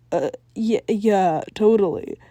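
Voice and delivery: ordinary delivery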